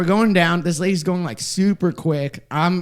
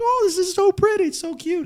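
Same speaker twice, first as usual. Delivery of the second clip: very high-pitched cooing; re-enacted delight; 13 semitones higher than usual. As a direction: gush over it in a tiny high voice. Acting own delight holding jellyfish.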